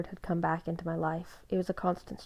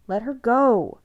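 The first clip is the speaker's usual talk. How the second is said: loud, higher command; urgent plea; 6 semitones higher, 10 dB louder, slower than usual. A narrator's reading. Seth tells Jacob to release Bella, urgent. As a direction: order him firmly.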